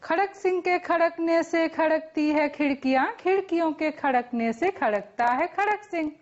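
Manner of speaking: quick, even rattle of a tongue twister; fast tongue twister. Shown as a demonstration. Teacher challenging students to match the speed, rapid tongue twister. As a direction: rattle it off without a break. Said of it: "હું જેટલી ઝડપથી બોલું".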